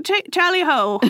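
lightly voiced British accent. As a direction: play a jolly British officer. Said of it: "they're British".

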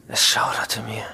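shuddering whisper. A narrator's reading. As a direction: breathe it out in a whisper. Narrator shudders at a newspaper.

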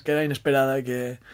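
ordinary delivery

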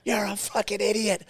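high, strained mock yell; slow, high-pitched retold insult; imitating angry screamer. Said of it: "he's screaming at me".

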